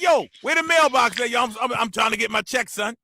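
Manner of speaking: put-on high New York accent; complaining New Yorker. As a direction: demand it like a lost New Yorker. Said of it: "They be like".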